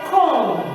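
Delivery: "comb" ending on a long M, no B; silent B demo. Shown as a demonstration. Teacher showing the B dies, "comb" with silent B. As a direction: hum the M that closes "comb", B silent. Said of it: "this one dies".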